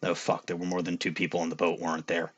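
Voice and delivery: low, quiet, fast mutter; under breath. Real, not acted. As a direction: mutter it to yourself, dismayed. Realizing mistake under breath.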